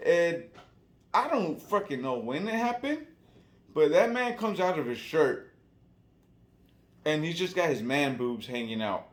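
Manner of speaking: usual speaking voice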